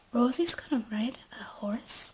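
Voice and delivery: unvoiced gossipy whisper; shocked whisper. Jade and Becky gossiping about Rosie, whispered.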